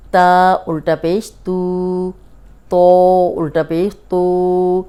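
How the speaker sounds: تا and تو drawn out slowly in a heavy emphatic voice, the vowel held two beats; thin vs heavy letter. Shown as a demonstration. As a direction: spell تا الٹا پیش تو slowly, holding the final vowel long. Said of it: "اتنا فرق ہے دونوں میں".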